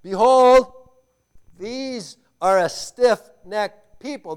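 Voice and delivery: loud, slow proclamation; booming divine pronouncement; God's stern verdict. Acted. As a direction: thunder it as God's judgment. God's verdict on his people.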